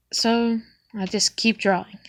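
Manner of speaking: usual speaking voice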